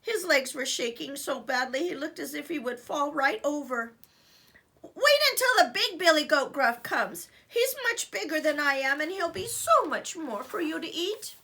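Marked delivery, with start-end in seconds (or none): 4.8-11.3 raised, high goat voice; high pleading goat. Acted. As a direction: plead it quickly. Middle goat bargaining.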